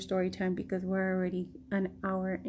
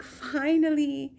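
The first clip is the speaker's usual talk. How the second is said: drawn out slowly, a touch louder; relieved finally; 6 semitones higher, 3 dB louder, slower than usual. Retold or imitated. She turns around to him at last, slow and relieved. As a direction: let the long wait melt out. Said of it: "I'm like".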